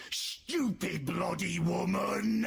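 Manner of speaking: slow, loud annoyed grumble; loud slow fed-up rant; exasperated outburst. Retold or imitated. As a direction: drag out the insult slowly. Angry at mother.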